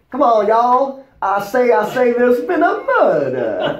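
a playful, over-the-top Southern accent; fake Southern accent. Shown as a demonstration. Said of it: "let's hear your Southern accent".

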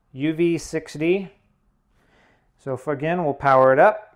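ordinary talk

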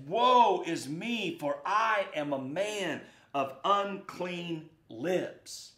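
slow, drawn-out woe; acted lament. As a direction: draw out a solemn confession, word by word. Acting Isaiah's lament before God.

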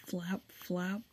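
"Flap, flap" with every letter carefully pronounced; careful pronunciation. Shown as a demonstration. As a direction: enunciate each sound of "Flap, flap", softly. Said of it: "pronunciate every letter in that word correctly".

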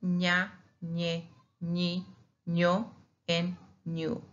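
"ña, ñe, ñi, ño, and ñu" with ny sounds; Spanish eñe drill. Shown as a demonstration. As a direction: say nya, nyeh, nyee, nyo, nyoo for "ña, ñe, ñi, ño, ñu". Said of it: "and we say ña".

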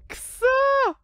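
high strained outcry; frustrated yell. Real, not acted. Player furious that the suspect died so easily, high frustrated yell. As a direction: cry out in disbelief. Frustrated outburst at dead NPC.

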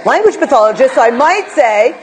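normal talking voice

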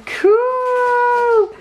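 high, long-drawn "cool"; delighted surprise. Real, not acted. Vlogger thrilled by an unboxed planner, delighted. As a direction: squeal it with delight.

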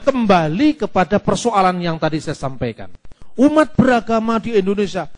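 ordinary delivery

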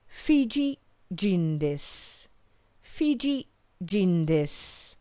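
drawn-out careful Japanese phrase; slow model phrase. Shown as a demonstration. Teacher modelling Kanada jin desu slowly for learners. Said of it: "you could say".

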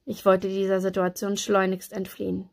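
plain reading voice

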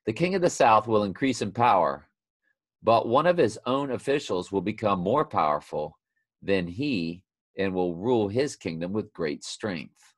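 emphatic Bible reading, opening high and loud; scripture reading. Acted. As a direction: proclaim the prophecy, then let it settle. Reads scripture aloud.

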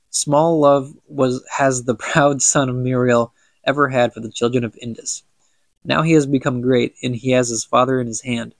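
ordinary delivery

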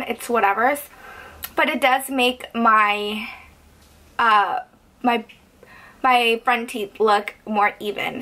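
slight retainer lisp; speaking over plastic tray. Real, not acted. Seen 0: Pressed retainer onto teeth just before; shows shiny teeth at +17.9.

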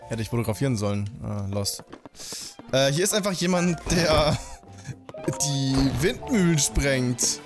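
normal talking voice

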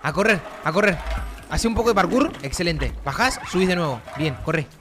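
loud, urgent cheering on a run; excited chase. Real, not acted. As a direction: urge the character on as it flees. Persecución tensa en el juego. (gritando para animar la huida)

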